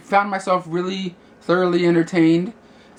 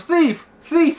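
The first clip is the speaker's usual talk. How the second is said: mock yell, much higher pitched; retold yelling; 10 semitones higher, 4 dB louder than usual. Blind kid calls out to people around him, mock yell. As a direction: imitate a kid crying thief, not at full volume. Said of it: "He starts yelling out".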